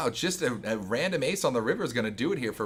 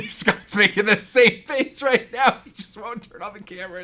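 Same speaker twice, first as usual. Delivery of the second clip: laughing; 10 semitones higher, 10 dB louder than usual. Real, not acted. Laughing at co-host's face.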